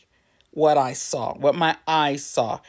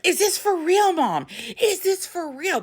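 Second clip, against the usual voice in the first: put-on excited child; 12 semitones higher than usual. Daughter asking mom about the find, excited high squeal. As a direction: squeal the question like a thrilled kid. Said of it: "They're excited about something".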